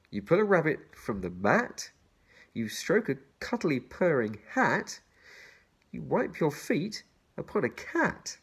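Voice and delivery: put-on bouncy children's rhyme read. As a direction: lilt each line to its rhyme. Reads the rhyme aloud.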